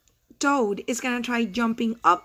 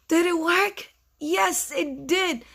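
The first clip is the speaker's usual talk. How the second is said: high-pitched delighted question and answer; acted excitement; excited discovery; 6 semitones higher, slower than usual. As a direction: lift the voice high in amazement. Celebrates the kite working.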